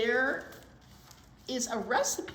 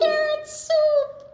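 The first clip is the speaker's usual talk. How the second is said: high, loud, slow delight; joyful reveal; 13 dB louder, slower than usual. A narrator's reading. Reader announces carrot soup to kids, high and delighted. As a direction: crow it out happily.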